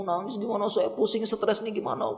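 high-pitched stressed whine; imitating a stressed, weepy grumble; mock tearful venting. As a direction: complain helplessly to others, pretending to cry. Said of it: "nangis dulu, ngadu-ngadu dulu".

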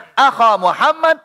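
"akha Muhammad" with a ending akha, high; case change. Shown as a demonstration. Teacher showing ending change, "akha" with high clear a.

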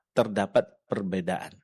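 normal talking voice

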